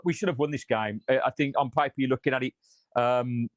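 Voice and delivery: ordinary delivery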